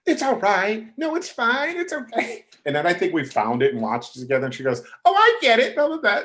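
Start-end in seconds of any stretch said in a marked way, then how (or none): 0.1-2.4 very high, put-on reassurance. Voicing [name] forgiving him. 5.1-6.2 loud, very high imitated reply. Voicing [name]'s reaction.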